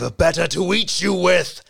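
loud snarling wolf; wolf roar. A narrator's reading. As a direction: roar it as he pounces. The wolf pounces.

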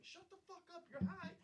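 high, held-back mock scolding; pissed-off brother. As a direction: imitate a brother's exasperated telling-off. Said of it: "like, pissed at me".

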